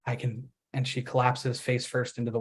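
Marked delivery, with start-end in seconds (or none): 0.1-0.5 much softer, hushed and hollow; hushed, broken-down repeat; fading repetition. A narrator's reading. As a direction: repeat it like a dying mantra. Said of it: "Penny says".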